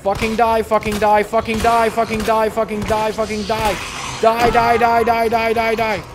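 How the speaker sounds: high loud rage yelling; frantic angry chant; furious screaming. Real, not acted. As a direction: scream at the monster to die. Fighting monster furiously.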